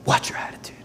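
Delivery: soft stern whisper; whispered warning. Real, not acted. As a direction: warn them under your breath.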